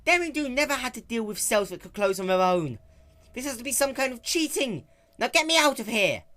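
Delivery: loud, high indignant complaint; upset Rainbow Dash. Acted. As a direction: demand to be let out.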